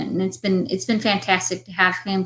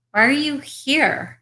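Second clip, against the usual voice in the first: slow question, HERE raised high and falling; stress on HERE; 6 semitones higher, slower than usual. Shown as a demonstration. Acting student shows inflection on each word, stress on HERE. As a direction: land the weight on "here", drawn out. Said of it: "showing the inflection on each word".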